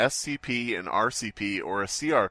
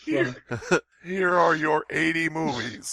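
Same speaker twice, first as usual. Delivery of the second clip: laughing; 10 semitones higher than usual. Acted. Acted forced movie watching.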